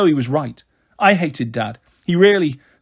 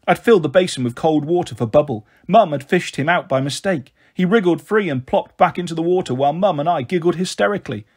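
usual speaking voice

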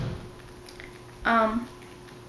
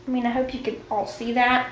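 ordinary talk

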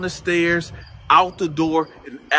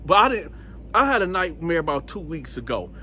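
ordinary talk

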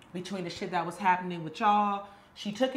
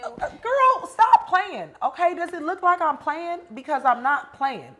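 angry scolding; 7 semitones higher, 6 dB louder than usual. Acted. Woman scolds flirting friend.

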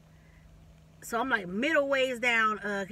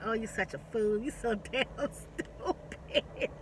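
quiet breathy laughter through the words; laughing at herself; 5 dB quieter, slower than usual. Retold or imitated. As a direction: chuckle at your own foolishness. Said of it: "I'm like".

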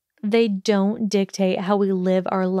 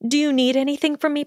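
rushed, high and eager question; student itching to go; 7 semitones higher, faster than usual. A narrator's reading. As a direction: hurry it out, bubbling. Eager to leave.

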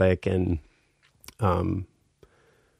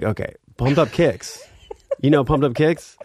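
raised, higher-pitched prompting; pitched up, pushing to get through; insistent retry; 7 semitones higher, 3 dB louder than usual. Retold or imitated. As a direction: press her to recognize the name. Trying again to make her understand.

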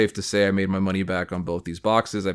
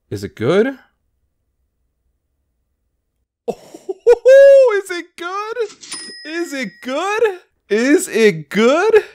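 shrieking far higher and louder, laughing; ecstatic squeal; 10 dB louder, much slower than usual. Real, not acted. Collector shrieking over a rare pull, ecstatic squeal. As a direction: squeal with disbelieving joy.

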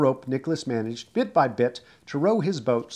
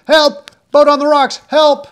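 very high, very loud desperate shout; shouting for help; 13 semitones higher, 14 dB louder than usual. A narrator's reading. Nick runs along the wharf shouting for help. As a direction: yell with all your strength for help.

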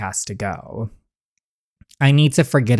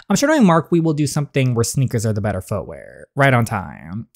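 ordinary talk, nothing marked

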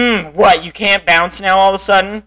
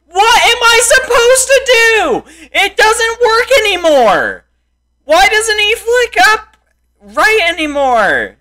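1 high whiny complaint; 10 semitones higher than usual. Real, not acted. Raging at game.

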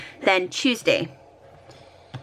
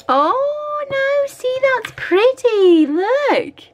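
much higher, thrilled gush; delighted squeal; 3 dB louder, much slower than usual. Real, not acted. Vlogger showing viewers the foil overlay, delighted squeal.

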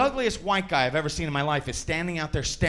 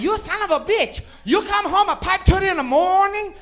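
much higher, loud angry wife, slowing down at the end; furious wife; 11 semitones higher, 6 dB louder than usual. Acted. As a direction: shriek it at the husband, dragging out the last words. Wife yelling back in joke.

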